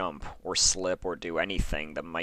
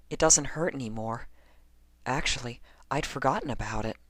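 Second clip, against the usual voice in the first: higher, lightly voiced young mare, unbothered; Lyra's voice; 7 semitones higher than usual. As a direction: play Lyra brushing it off, pitched up. Lyra answers in story.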